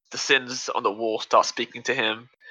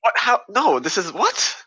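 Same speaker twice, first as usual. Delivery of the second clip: baffled squeak; 9 semitones higher, slower than usual. Retold or imitated. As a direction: voice jumping much higher with each what. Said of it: "And it's like".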